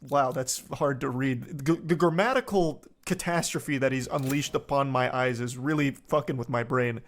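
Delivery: ordinary delivery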